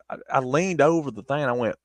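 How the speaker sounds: ordinary talk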